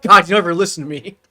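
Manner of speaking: fake-scold the partner, half joking; mock complaint. Teasing the partner for never listening, hammed-up complaint.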